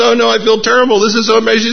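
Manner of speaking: hammed-up flustered apology, way up in pitch; mortified preacher.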